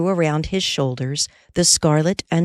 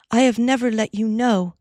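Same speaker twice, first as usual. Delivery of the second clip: higher Molly voice; 7 semitones higher than usual. Acted.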